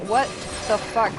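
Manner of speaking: ordinary delivery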